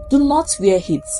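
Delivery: ordinary delivery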